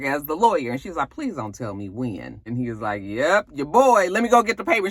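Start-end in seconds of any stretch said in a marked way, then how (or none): 1.1-2.4 soft, low dread; mild hushed dread. Retold or imitated. Wife dreading the lawyer's answer. 3.0-4.9 mock-smug husband. Husband smugly confirming he signed.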